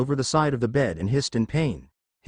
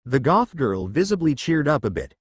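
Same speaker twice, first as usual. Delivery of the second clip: ordinary delivery, nothing marked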